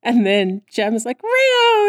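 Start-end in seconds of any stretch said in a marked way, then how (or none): 1.2-1.9 very high, loud hammed-up cry; mock desperate call. Jem runs up to Rio, mock desperate call. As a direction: call out shrieking for a lost love.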